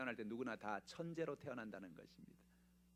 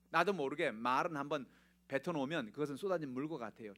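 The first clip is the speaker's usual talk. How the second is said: ordinary delivery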